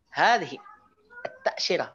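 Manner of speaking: "Hadhihi" high and falling, pause, then high "at-ta'syirah"; emphatic "Hadhihi" set apart from "at-ta'syirah"; intonation with pause. Shown as a demonstration. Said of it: "Ada jeda begitu pakai intonasi".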